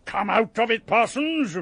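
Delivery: usual speaking voice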